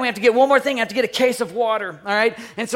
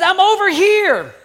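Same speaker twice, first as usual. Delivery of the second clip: high, slow, loud holler; calling back; 10 semitones higher, 6 dB louder, slower than usual. Retold or imitated. Son calls back to his searching dad, hollering. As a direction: holler back, stretching the words.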